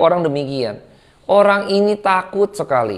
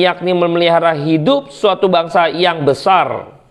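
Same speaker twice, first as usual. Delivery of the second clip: scripture reading. Acted. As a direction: read the passage solemnly from the pulpit. Reads scripture passage aloud.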